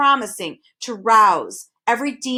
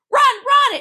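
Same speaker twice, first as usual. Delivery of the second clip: much higher, loud shout; crying out; 8 dB louder than usual. A narrator's reading. Hidden voice urging the boys to flee, loud shout. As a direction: yell it urgently from afar. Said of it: "it urged once more".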